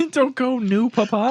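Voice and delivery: put-on high whiny kid voice. Mocking kid begging.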